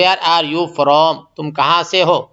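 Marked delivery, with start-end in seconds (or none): 0.0-1.2 slow English phrase. Shown as a demonstration. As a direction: drag each word out for the class. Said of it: "English میں بول سکتے".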